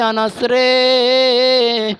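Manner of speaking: high, long-held chanted call. Acted. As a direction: call the name and stretch the last vowel. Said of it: "নবী ডাক দিয়া বলে".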